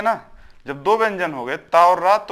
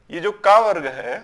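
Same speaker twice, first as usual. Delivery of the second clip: usual speaking voice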